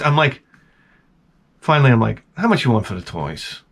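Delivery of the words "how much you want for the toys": low, soft, offhand question; feigned indifference. Retold or imitated. Dad haggling with seller, imitated casual indifference. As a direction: ask as if barely interested.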